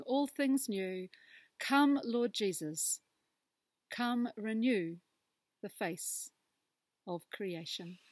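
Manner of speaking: slow, hushed prayer voice; prayer reading. Acted. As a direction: read it like a gentle prayer. Reads closing prayer aloud.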